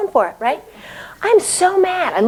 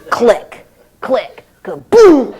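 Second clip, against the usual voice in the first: mock door sound effect; 6 dB louder, much slower than usual. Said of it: "sound effect of door".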